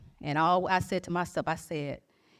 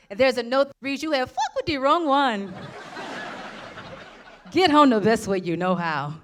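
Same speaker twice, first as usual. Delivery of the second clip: kind agent voice; 2 semitones higher than usual. Retold or imitated. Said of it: "most kind South African woman".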